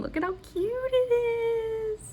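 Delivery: high-pitched cooing over something cute; gushing squeal. Real, not acted. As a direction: let it rise into a thin squeal. Gushing over the new kit.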